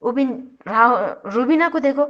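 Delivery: usual speaking voice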